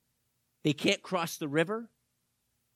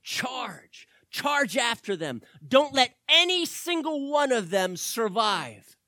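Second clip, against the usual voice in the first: angry command; 7 semitones higher, 8 dB louder, slower than usual. Retold or imitated. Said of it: "And Pharaoh said".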